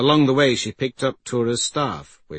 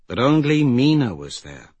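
plain reading voice

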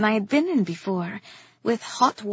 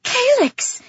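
high, loud gasped name; shocked gasp; 14 semitones higher, 7 dB louder than usual. A narrator's reading. Wife gasps at her husband's threat, shocked. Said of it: "I gasped".

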